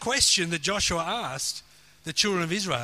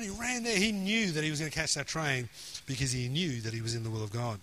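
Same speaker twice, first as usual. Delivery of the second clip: ordinary delivery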